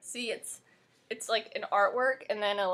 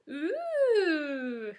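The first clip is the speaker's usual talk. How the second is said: delighted ooh; 7 semitones higher than usual. Real, not acted. Opening the album.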